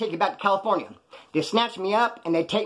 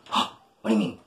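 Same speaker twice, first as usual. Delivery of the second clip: gasping low voice; 8 semitones lower than usual. Retold or imitated.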